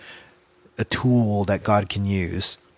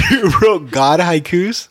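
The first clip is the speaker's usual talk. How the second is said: teasing disbelief; 5 semitones higher, 4 dB louder than usual. Real, not acted.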